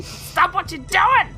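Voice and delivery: ordinary talk, nothing marked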